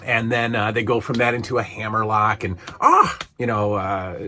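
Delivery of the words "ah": high, loud yelp of feigned pain; short put-on "ah" of agony; mock pained cry. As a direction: imitate a wrestler selling a hold. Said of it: "Lord Alfred is selling the hammerlock".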